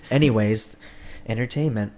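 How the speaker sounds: normal talking voice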